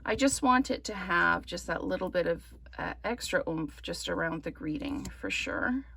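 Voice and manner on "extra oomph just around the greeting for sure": ordinary delivery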